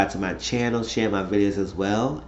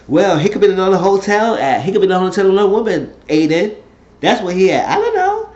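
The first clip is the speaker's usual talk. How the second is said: higher, louder indignant outburst; retold heated retort; 9 semitones higher, 6 dB louder, faster than usual. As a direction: argue it insistently, acting out the quarrel. Retold heated remark to Aiden.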